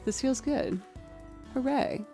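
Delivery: usual speaking voice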